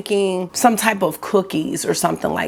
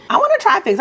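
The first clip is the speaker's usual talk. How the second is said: eager; 12 semitones higher, much faster than usual. Real, not acted. Excited about trying figs.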